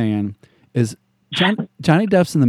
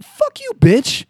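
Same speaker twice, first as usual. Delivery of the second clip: much higher, slow, exaggerated snarl; mock retort; slower than usual. Answering the perfume ad, slow high mock snarl. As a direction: stretch it out as a hammed-up comeback. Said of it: "I'm like".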